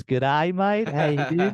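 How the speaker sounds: a jokey broad Australian greeting, pitch rising on mate; mock Australian accent. Teasing the Australians he'd be cast alongside, mock Australian accent. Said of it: "the right accent, not these Australians".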